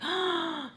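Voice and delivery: sharp high gasp, acted out; scared gasp. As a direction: suck in air as if frightened. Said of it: "like, scared".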